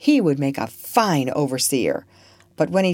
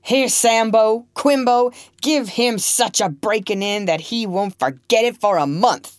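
angry master; 6 semitones higher than usual. Acted. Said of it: "roared Legree".

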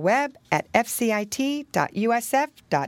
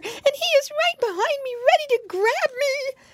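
squeaky, very high pig voice; high childlike alarm; frightened piglet. A narrator's reading. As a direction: squeal it as the chased piggy boy. Piglet tells of danger.

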